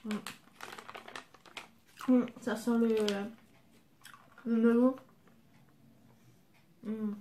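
sucking on candy; gagging and spitting out; candy in mouth. Real, not acted. Seen 3: Candy in mouth early; then bends over, covers mouth, spits into tissue.

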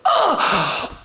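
sharp high gasp with a loud oh; shocked gasp. Retold or imitated. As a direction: gasp as if something just bit you. Sudden pain in the foot.

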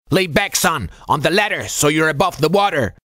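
high, gruff instruction; strained, high-pitched chief voice; old chief instructing. A narrator's reading. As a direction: direct him firmly as the old chief. Chief instructs wounded sailor.

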